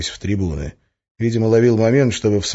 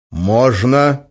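convinced; 6 semitones higher, 7 dB louder than usual. A narrator's reading. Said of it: "ответил я убеждённо".

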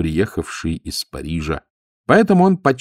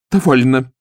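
louder, higher firm command; 5 semitones higher, 5 dB louder than usual. A narrator's reading. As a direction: cut them off decisively. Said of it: "обретая твёрдость голоса".

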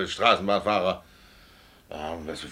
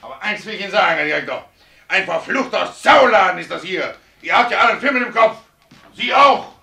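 loud, high, furious shouting; furious outburst; 10 semitones higher, 7 dB louder than usual. Acted. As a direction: lets his temper blow at the boss. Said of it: "Dann platzt einmal der Kragen". (wütend und laut, platzt der Kragen)

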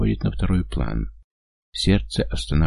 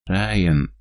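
ordinary delivery

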